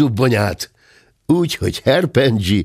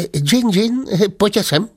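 startled Turk; 10 semitones higher than usual. Acted.

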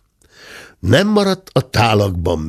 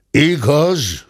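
Igaz higher and louder, falling; grumbled aloud; 7 semitones higher, 4 dB louder than usual. A narrator's reading. The squire conceding to the cub, louder grumble. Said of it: "dörmögte a ténsúr".

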